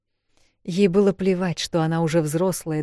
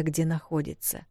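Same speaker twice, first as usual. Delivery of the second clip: usual speaking voice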